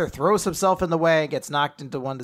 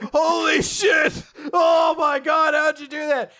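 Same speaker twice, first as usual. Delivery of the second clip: high, loud, slow mock horror; shocked shout; 13 semitones higher, 6 dB louder, slower than usual. Everyone sees her head ripped off, shocked shouting. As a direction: holler it in hammed-up disbelief.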